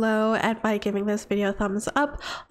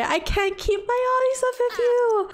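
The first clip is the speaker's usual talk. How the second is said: much higher, dreamy voiced compliment; swooning lover; 10 semitones higher than usual. Villager voiced complimenting spouse, higher and swooning. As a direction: gush like a smitten spouse.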